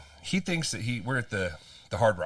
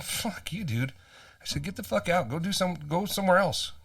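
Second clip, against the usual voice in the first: angry; 9 semitones higher than usual. Retold or imitated. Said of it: "And I said".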